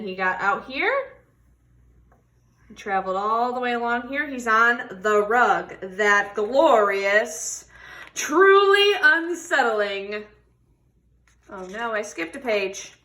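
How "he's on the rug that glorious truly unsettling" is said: expressive picture-book read-aloud; reading to children. Acted. Teacher reading a tortoise story to children, expressive. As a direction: tell the story warmly to a class.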